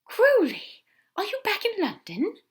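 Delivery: high, loud gasp of surprise. A narrator's reading. Aziraphale gasps, startled to hear from Crowley. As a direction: catch your breath and call out his name. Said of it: "Aziraphale gasped".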